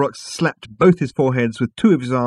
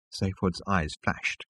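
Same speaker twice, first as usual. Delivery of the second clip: plain reading voice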